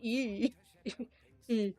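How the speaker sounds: "Ew" said with a French vowel; lightly put-on French accent on "Ew". Shown as a demonstration. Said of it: "with, like, a French accent".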